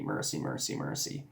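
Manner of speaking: low, near-whispered murmur of the word; hushed repetition. Retold or imitated. Recalling a word echoing in his head, murmured quietly. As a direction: let the word drift out softly, again and again. This thing of mercy just kept coming back to me.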